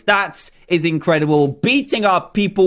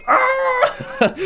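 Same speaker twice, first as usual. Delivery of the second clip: very high squealed "aah"; frantic scream. Real, not acted. Player dashing to beat a rival, high frantic scream. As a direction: squeal in last-second desperation. Last dash in the race.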